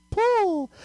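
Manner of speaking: put-on high-pitched little-boy call; macho ten-year-old yell. Boy yelling for the clay to launch, mock macho squeal. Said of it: "in my most macho ten-year-old voice, I yell".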